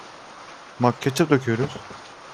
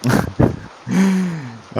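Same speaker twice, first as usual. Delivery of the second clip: loud high laugh; 7 semitones higher, 4 dB louder than usual. Real, not acted. Burst of laughter at failure.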